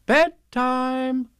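high, loud sing-out; motherly announcement. A narrator's reading. Kanga putting Roo to bed, high motherly call.

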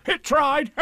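very high, insistent tiger voice; excitable storybook tiger; Tigger. A narrator's reading. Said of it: "said Tigger".

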